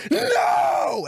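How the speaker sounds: high, anguished mock scream; acted scream. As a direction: cry out as you witness the killing blow. Acting Ellie's cry at killing blow.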